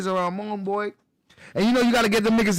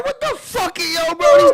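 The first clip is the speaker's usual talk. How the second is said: high, shocked exclamation in disbelief; retold outburst; 13 semitones higher, 6 dB louder, slower than usual. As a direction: blurt it out, stunned and high. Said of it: "I said".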